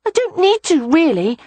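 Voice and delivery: put-on a little boy arguing he can already count; high rushing child voice; protesting small boy. A narrator's reading. Rye arguing against maths.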